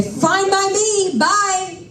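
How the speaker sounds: high, slow, sarcastically cheery goodbye; sing-song dismissal. Retold or imitated. To drunk friend storming out, a high slow sarcastic farewell. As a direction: trill it out sweetly to get rid of him.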